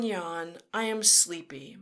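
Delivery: ordinary delivery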